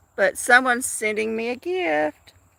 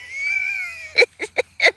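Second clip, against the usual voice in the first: high burst of laughter; helpless high-pitched laugh. Real, not acted. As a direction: crack up at your own joke. Laughing at her own joke.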